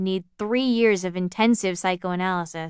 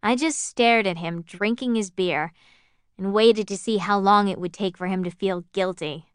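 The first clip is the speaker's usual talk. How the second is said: plain reading voice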